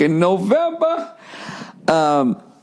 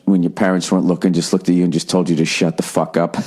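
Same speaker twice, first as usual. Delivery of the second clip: ordinary talk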